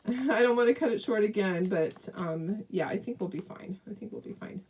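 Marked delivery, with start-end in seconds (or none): 3.9-4.6 soft whispered reassurance to herself. Real, not acted. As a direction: breathe it, no voice.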